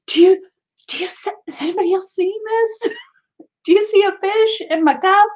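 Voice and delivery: as if you can't believe what's in your cup; astonished disbelief. Pretend. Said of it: "He looks at, at us like".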